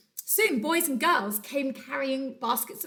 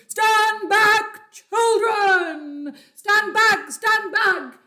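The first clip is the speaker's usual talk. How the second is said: much louder and higher warning shout; booming shout; 10 semitones higher, 11 dB louder, much slower than usual. Acted. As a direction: bellow from the jungle to save the children. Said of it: "deep voice from the jungle shouted".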